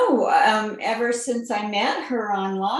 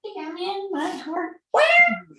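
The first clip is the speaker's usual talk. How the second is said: squeaky voice; 12 semitones higher than usual. Pretend. Playful squeaky bit.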